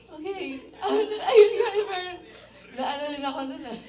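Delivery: emotional laugh, half crying; giggling while choked up; laughing through tears. Real, not acted. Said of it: "parang gumagano yung mukha ko".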